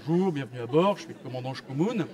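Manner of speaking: low-pitched cabin announcement voice; airline captain. Acted. Captain greeting passengers before take-off, low announcer voice. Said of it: "le petit mot du commandant".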